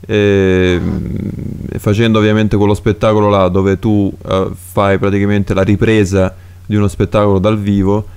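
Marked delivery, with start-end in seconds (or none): none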